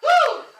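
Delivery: high, loud "woo"; exclaimed whoop. Real, not acted. Preacher moved mid sermon, loud high whoop. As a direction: let out a sharp, high whoop.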